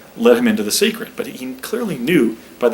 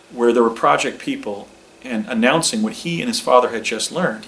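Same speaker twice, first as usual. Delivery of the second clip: ordinary delivery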